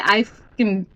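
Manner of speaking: usual speaking voice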